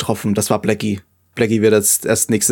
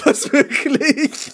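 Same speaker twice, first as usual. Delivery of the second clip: high-pitched shocked laugh; surprised; 12 semitones higher, much slower than usual. Real, not acted. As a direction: squeak out the disbelief. Shocked by writer news. (überrascht, lachend)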